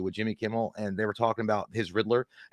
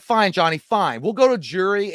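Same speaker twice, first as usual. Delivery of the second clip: taunting wife; 7 semitones higher, 6 dB louder, slower than usual. Retold or imitated. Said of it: "then she's like".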